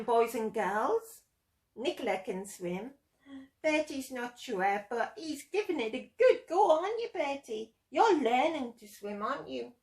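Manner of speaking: usual speaking voice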